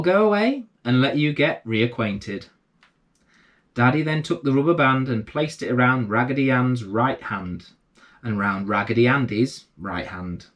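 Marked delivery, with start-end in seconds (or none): none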